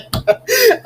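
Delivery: squealing, breathless laughter; high helpless laugh. Real, not acted. Streamer cracks up at a chat joke, high squealing laugh. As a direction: lose it completely at the joke.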